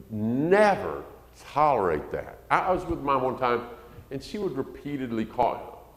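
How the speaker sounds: normal talking voice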